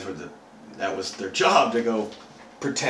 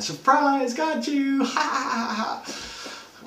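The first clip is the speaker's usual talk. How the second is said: very high, squealed surprise with fake laughing; mock gleeful reveal; much slower than usual. Party guests springing the prank, mock gleeful. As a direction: imitate party guests cackling in high voices.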